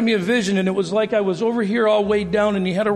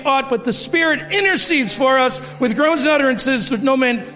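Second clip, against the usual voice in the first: higher, more forceful sermon voice; rising preaching; 4 semitones higher than usual. Acted. Pastor urging the congregation about their equal callings, in a rising preaching voice. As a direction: drive the point home to the congregation.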